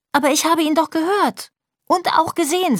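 put-on high-pitched, protesting little girl; high childlike protest; insistent child. A narrator's reading. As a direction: insist to dad in a small child's voice. Child insists to dad, story.

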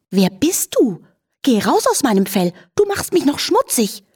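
high, affronted protest; indignant horse. A narrator's reading. Horse indignant at the intruding flea.

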